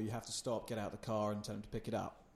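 usual speaking voice

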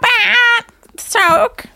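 high, slow, grand announcement; excited. Acted. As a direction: announce it like a showman. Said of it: "be like".

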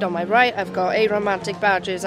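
ordinary talk

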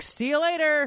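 high, breezy mom brush-off; retelling a follower's mom, high and breezy; carefree mom. Retold or imitated. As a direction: toss it off like a mom glad the kids are gone. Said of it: "She's like".

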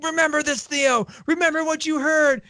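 a hint of desperate urgency; urgent parting plea. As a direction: call it back to him as he's dragged off. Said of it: "he's like".

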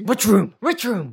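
high, loud interrogation; insistent acted shout; urgent demand. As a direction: fire the question at the bear. Pressing the cowering bear.

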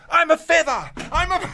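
high, loud, hammed-up declaration; mock proud boast. Teammate with the ball at training, high loud mock boast. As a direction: announce it to the team, hammed up. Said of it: "be like".

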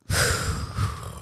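long soft exhale; calming sigh. Real, not acted. Woman takes a moment to breathe mid-argument, calming sigh. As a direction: breathe out slowly to settle down.

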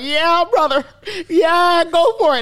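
loud, high hype-man cheer; hyped friend. Retold or imitated. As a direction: egg him on like a hype man. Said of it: "Tresor's recording it like".

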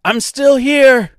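shouting over a roaring river; loud high yell. Acted. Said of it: "she yelled over the noise".

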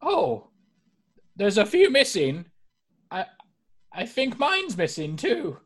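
put-on high, fluttery old lady voice; elderly singsong. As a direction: fret sweetly like a granny. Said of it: "she starts looking through and goes".

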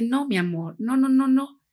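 put-on gentle high "no, no, no" to a small child; calm the little boy down, rising on the nos; soothing a child. Calming a child about to hit.

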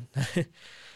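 short real chuckle; brief amused laugh. Real, not acted. Host amused while wrapping up the podcast, chuckling.

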